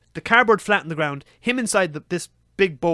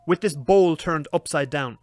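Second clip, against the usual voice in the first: normal talking voice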